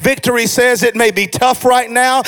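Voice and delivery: rhythmic sermon build; insistent pulpit cadence, gathering force; full-force preaching. Real, not acted. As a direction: drive each declaration home to the congregation.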